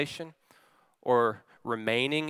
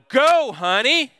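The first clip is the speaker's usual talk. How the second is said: high, loud, drawn-out yell; re-enacted panicked dad yell; guttural yell; 10 semitones higher, 7 dB louder, slower than usual. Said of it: "in my innermost guttural yell".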